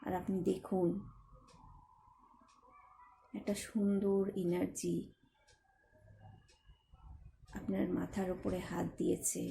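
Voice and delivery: soft, even meditation voice with long pauses; guided meditation. Acted. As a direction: lead them gently inward, phrase by phrase.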